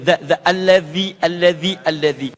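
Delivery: "za" and "Allazi" with z as a voiced th; careful Arabic dhal drill on "za" and "allazi"; tongue slightly bitten. Shown as a demonstration. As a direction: model "Allazi" with the tongue tip between the teeth. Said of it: "itu sedikit digigit lidahnya".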